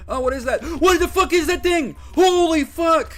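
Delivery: screaming in fright at a sudden monster; terrified scream. Real, not acted. Streamer screaming at a monster in the game, terrified scream. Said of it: "did not mean to scream so loud".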